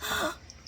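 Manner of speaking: short dramatic intake of breath; mock gasp. Woman guessing about his father, mock gasp. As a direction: gasp as if realizing. Retold woman gasps in realisation.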